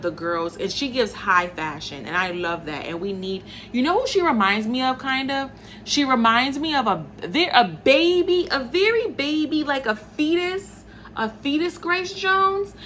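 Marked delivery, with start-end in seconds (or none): 3.7-12.7 excited build. Real, not acted. Excited comparison, voice rising high.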